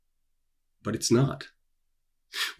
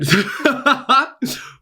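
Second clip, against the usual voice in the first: high-pitched giggling fit; high giggle; 5 dB louder than usual. Real, not acted. Author giggling at his own slip-up, high giggle. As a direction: burst into helpless giggles. Cracking up after losing track.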